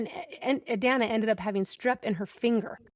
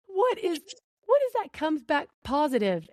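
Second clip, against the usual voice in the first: higher, rising alarm over the question; alarmed disbelief; 7 semitones higher than usual. Retold or imitated. Mom realizing the test result, higher alarmed disbelief. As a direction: sound suddenly worried. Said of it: "And I was like".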